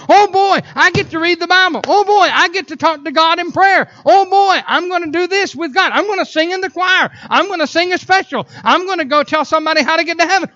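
bursting with church-going zeal; excited. Acted. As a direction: rattle off each thing with rising zeal. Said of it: "Where's that excitement at?".

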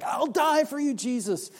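eager pledge. Retold or imitated. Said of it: "makes the progression from one who is like".

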